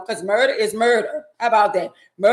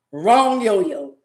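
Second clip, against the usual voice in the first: usual speaking voice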